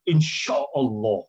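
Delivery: slow, stretched Insya Allah, the sya drawn out in phases; affected drawn-out phrase. Retold or imitated. Teacher mocking a dangdut singer on TV, slow and stretched. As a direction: drag each syllable of Insya Allah like a show-off singer. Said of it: "ngomong sya itu kayak di fase-fase".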